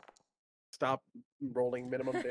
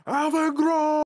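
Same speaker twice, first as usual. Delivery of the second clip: roared at the top of his lungs; battle cry; 12 dB louder, much slower than usual. Acted. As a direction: bellow the war name, high and loud. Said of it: "a battle cry".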